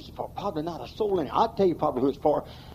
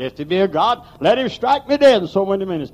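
Ingersoll scoffing; 4 semitones higher, 8 dB louder than usual. Retold or imitated. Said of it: "That's what poor old ignorant Ingersoll said".

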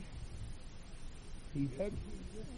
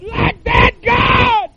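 crying shout; 7 dB louder, much slower than usual. Retold or imitated. Repeats Wigglesworth's cry to God.

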